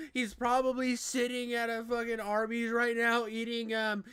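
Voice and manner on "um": ordinary talk, nothing marked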